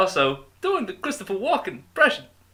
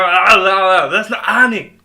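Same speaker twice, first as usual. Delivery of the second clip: put-on German accent; 3 semitones higher, 9 dB louder than usual. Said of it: "You sounded German".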